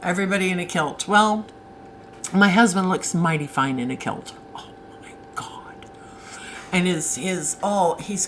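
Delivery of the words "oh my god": breathless whispered oh my God; dreamy, awed whisper; swooning whisper. Real, not acted. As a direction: whisper it as if overcome. Swooning over husband in kilt.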